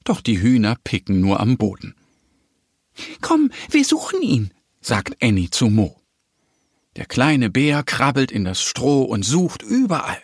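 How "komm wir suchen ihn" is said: high squeaky duck voice; urging little duck. A narrator's reading. Little duck urges the bear to search the straw, encouraging. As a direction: urge a friend along in a high voice.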